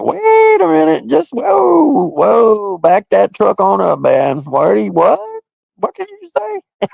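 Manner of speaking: high, shrill-leaning mock alarm. Reacting to the lyric in high mock alarm. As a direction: freak out in a high mock panic. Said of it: "I'd be like".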